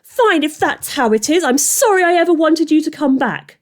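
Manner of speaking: a high, loud angry yell; girl yelling at her brother. A narrator's reading.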